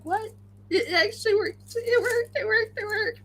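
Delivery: high, delighted disbelief; can't believe it worked, bubbling over; excited. Retold or imitated. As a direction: pitch leaps up with each worked. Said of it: "I was like".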